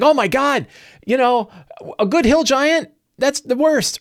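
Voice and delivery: high, sarcastic fake horror at a good hill giant; mock outrage. Mocking upset fans over friendly giants, high fake horror. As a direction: parody the scandalized fan, pitch up. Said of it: "And everyone's like".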